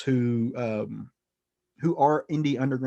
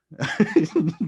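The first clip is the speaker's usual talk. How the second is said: real laugh; 6 semitones higher, 3 dB louder than usual. Real, not acted. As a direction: burst of amused laughter. Laughs at dark punchline.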